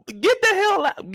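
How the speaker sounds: high, slow yell at the screen; high drawn-out yell; exasperated yell. Real, not acted. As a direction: drive her out of the church. Yelling at Lucille on screen.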